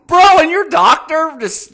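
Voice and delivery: loud, very high, drawn-out disbelief; slow, high exasperated cry; exasperated outburst. Real, not acted. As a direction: burst out incredulous at the top of your pitch. Exasperated rant at the writer's doctor.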